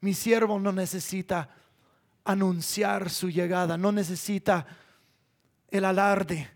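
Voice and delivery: low, soft, measured statement; God speaking gently. Acted. God describing his servant who needs no display, soft and calm. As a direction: quietly set it apart from the clamor.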